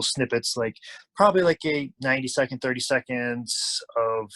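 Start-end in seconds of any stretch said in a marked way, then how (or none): none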